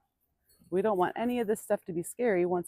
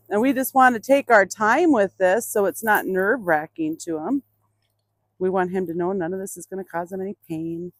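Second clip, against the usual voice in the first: usual speaking voice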